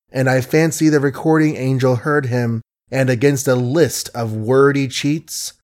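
ordinary delivery

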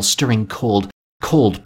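ordinary delivery

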